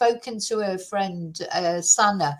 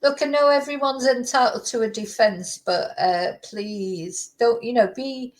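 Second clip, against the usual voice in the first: ordinary talk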